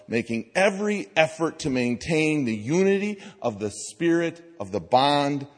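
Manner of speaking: loud, ringing scripture read; preaching a Bible passage. Acted. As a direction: proclaim Paul's words to the church. Reads scripture aloud to congregation.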